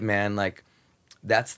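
ordinary talk, nothing marked

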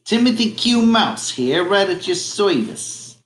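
high, put-on mouse voice; Timothy Q Mouse. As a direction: introduce yourself as a storybook mouse, high-pitched. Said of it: "I'll act out the characters".

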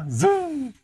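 high swooping zoom sound; whooshing zoom. Retold or imitated. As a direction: swoop the word up and away like a flying thing. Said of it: "dia terbang ah".

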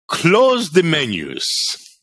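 mock heavy-accented waiter; imitating Dino's accent. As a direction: drag out the order like the old waiter. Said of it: "with his accent".

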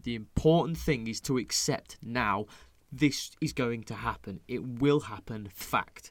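each word slow and deliberate; slow, firm advice. Retold or imitated. Telling a friend setbacks will come, slow and firm. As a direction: lay it down slowly, like a fact. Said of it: "I went".

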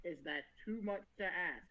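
soft, low, tired plea; understated weary Guts; weary quiet plea. Retold or imitated. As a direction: ask it like an exhausted man. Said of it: "Guts is sitting there just like".

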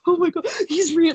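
high, starstruck mock freak-out; high-pitched, hyperventilating disbelief; overwhelmed fan. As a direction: act out losing it over your idol. Retold hyperventilating at seeing her idol.

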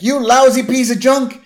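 high, loud acted insult; mock rage at a broken gadget; angry outburst. Recapping a character cursing his gadget, angry outburst.

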